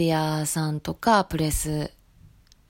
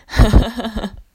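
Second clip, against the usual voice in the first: a burst of real, loud laughter; 4 semitones higher, 7 dB louder than usual. Real, not acted. Laughing at her own leg worry after asking listeners to let it go, real loud laugh.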